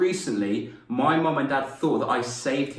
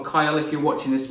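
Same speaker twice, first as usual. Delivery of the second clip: ordinary talk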